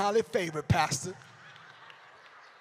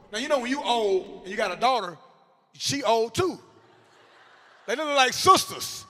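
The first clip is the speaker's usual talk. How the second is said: ordinary talk, nothing marked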